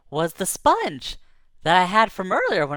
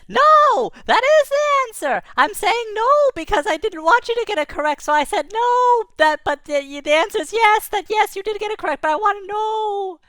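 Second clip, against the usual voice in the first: very high, rushed little creature; a mischievous spark owning up; squeaky light voice; 13 semitones higher, 5 dB louder than usual. Acted. Ball of light admitting its trick, very high.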